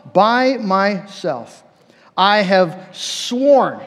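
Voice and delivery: very slow, weighty oath, each word drawn out; solemn oath. Acted. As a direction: lay down God's vow slowly and gravely. Repeating God's oath for emphasis.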